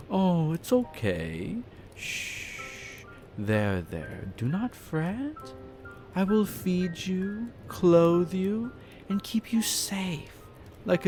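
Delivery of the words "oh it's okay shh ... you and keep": slow, soft cooing to a frightened pet; gently patronising reassurance; soothing. Acted. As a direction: hush and comfort, drawing the words out.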